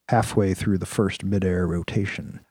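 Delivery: ordinary delivery, nothing marked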